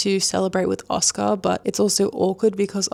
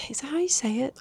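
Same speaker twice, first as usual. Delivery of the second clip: half-secret whisper of doubt; whispered aside; 5 semitones higher, 6 dB quieter, faster than usual. Real, not acted. Checking how to say the name, whispered aside. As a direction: whisper it to yourself, unsure.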